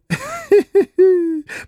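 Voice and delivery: very high, giggly hee-hee; high-pitched titter. A narrator's reading. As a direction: giggle thinly in character. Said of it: "прохихикал Максимов". (тоненькое хихиканье)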